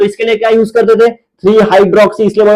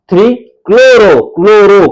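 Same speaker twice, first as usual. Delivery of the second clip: Three chloro said slowly and louder, each syllable leaned on; slow emphatic correction; 3 dB louder, slower than usual. Shown as a demonstration. Teacher drilling students on chloro, slow and emphatic. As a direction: drag out chloro to drive the correction home. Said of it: "chlorine नहीं".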